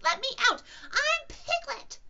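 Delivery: loud, very high squealed plea; crying out. Acted. Piglet scrubbed in Kanga's bath, high cry. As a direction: squeal each demand with full force. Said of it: "cried Piglet".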